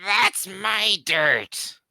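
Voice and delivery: high, slow, whining creature; whiny creature voice. Acted. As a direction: whine it out like a possessive goblin. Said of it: "low, whiny voice".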